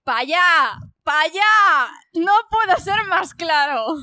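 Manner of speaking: loud, high pointing command; insistent shout. Acted. Figure shouting at Ellen to head north, loud insistent command. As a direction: bark it out, slowing on the last line.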